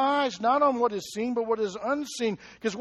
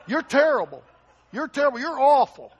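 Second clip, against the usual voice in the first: mock scolding; 4 semitones higher, 8 dB louder than usual. Teammates berating in the huddle.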